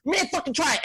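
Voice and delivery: high, garbled tweaker dare; mock a mush-mouthed tweaker picking a fight; Jason. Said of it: "And he was like".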